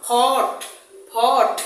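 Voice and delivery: loud ফট with a drawn-out vowel; long vowel. Shown as a demonstration. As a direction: lean on ফট and stretch its vowel. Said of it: "ফট বলবো না".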